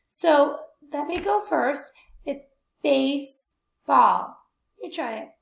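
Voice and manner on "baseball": BASEBALL slowly split as "base... ball"; syllable by syllable. Shown as a demonstration. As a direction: say BASEBALL in two drawn-out, separate beats. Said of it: "How many times does your chin go down".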